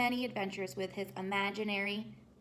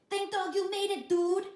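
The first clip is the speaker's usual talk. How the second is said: high, loud, excited chihuahua; excited exclaim; 10 semitones higher, 7 dB louder than usual. A narrator's reading. Chihuahua greeting his friend, high excited exclaim. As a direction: greet him with relief. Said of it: "exclaimed Don Diego".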